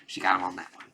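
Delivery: hushed amused whisper; whispered aside to viewers. Real, not acted. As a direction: murmur it like a secret joke. Reviewer's impressed aside.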